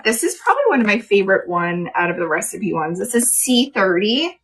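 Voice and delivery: ordinary talk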